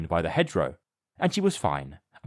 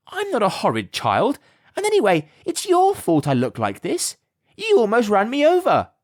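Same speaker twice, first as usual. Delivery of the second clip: put-on much higher and louder indignant child; a little girl protesting hard; unimpressed girl; 12 semitones higher, 5 dB louder than usual. A narrator's reading. Alice answers the insult, high indignant child. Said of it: "cried Alice, in the most unimpressed voice".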